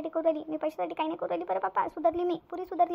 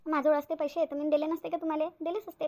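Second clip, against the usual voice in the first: normal talking voice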